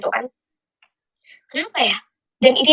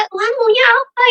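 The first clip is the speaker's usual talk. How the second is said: high-pitched, held-back outcry to God; retold distraught plea; 10 semitones higher than usual. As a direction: re-enact wanting to scream but holding it in. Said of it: "saya kayak mau teriak".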